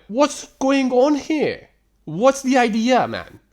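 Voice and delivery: high-pitched, drawn-out disbelief; exasperated. Real, not acted. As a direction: demand to know the point. Exasperated rant at the texter.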